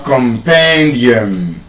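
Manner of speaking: "compendium" slow, PEN stressed; penultimate stress. Shown as a demonstration. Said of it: "the second to the last syllable".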